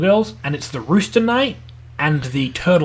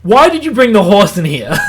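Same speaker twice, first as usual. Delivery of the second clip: higher, louder retold complaint; mock indignant demand; 4 semitones higher, 6 dB louder than usual. Waking up to a horse in his apartment, mock indignant. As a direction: imitate an outraged host confronting a guest.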